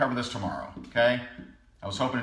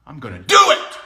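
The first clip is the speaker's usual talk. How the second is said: imitating a sudden angry outburst; acted fired-up shout; angry resolve; 9 dB louder than usual. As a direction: burst out loud on the decision. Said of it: "he was angry".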